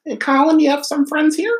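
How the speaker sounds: put-on high, far old lady voice; grandma voice. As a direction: imitate a nosy grandma. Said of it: "your grandma's gonna ask".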